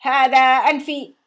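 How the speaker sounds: loud slow robotic yell; machine shouting. A narrator's reading. Talking machine echoes the shout, loud and slow. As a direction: bellow back like a mimicking machine. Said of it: "فصرخت الآلة المتكلمة".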